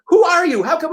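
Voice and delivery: high-pitched retold amazement; amazed questioning. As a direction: imitate strangers eagerly wanting to know. Group now amazed by him, retold.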